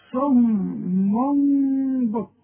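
بكم with tanween turned to a hidden م, very slow; iqlab demo. Shown as a demonstration. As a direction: drag صم بكم slowly, nasal م. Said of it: "شوف". (إقلاب التنوين ميما ببطء شديد)

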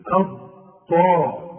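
"أض طا" slowly in two distinct pieces; separated letters. Shown as a demonstration. Teacher warning not to lose a letter, separated letters. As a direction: keep the heavy ض apart from the ط. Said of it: "اوعى تضيع لي حرف".

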